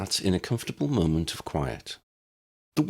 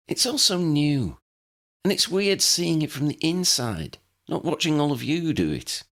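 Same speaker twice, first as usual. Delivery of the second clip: higher, lightly voiced girl, unsure of herself; a nervous new witch thinking aloud; gently fretting teenage voice; young Lydia, uneasy; 11 semitones higher than usual. A narrator's reading.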